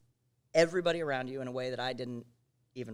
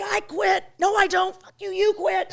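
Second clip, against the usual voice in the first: yelled much higher and louder, in a full rage; furious yelling; 10 dB louder than usual. Retold or imitated. Co-host re-enacts yelling at her drinking partner, furious shout. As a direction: scream it like you're storming out of the studio. Retold blowup, papers flying.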